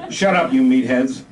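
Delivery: loud, sharp order; lieutenant scolding his squad, loud and angry; pissed off. Acted. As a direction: bark it at the squad. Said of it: "Lieutenant North was pissed".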